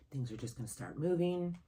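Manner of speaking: normal talking voice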